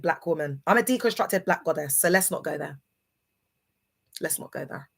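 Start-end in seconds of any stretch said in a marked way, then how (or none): 4.2-4.9 much softer, near-whispered warning; hushed warning. Real, not acted. Streamer warning off challengers, hushed. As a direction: drop it almost to a whisper.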